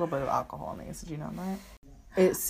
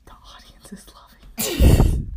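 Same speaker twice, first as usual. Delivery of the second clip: whisper; 7 semitones higher, 11 dB louder, much slower than usual. Acted. Mock aside during the podcast.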